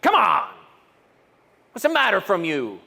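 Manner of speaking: sharp scolding "Come on!"; a frustrated teacher's rebuke; mock rebuke. Shown as a demonstration. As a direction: snap at them. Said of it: "Was this a rebuke?".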